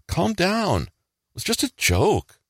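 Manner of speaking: high-pitched mock-hurt protest; acted hurt, confused. Friend denying the hidden aggression, acting hurt and confused. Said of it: "the first one can act hurt and confused".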